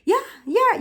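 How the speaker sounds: high, lively chain of agreement; demonstrating animated, high-pitched assent; emphatic African affirming. Shown as a demonstration. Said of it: "the way we affirm things".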